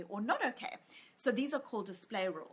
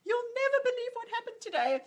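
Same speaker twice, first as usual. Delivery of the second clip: high, loud, bursting to tell; excited news; 14 semitones higher, 8 dB louder than usual. Retold or imitated. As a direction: burst out the big news to husband. Telling husband excitedly.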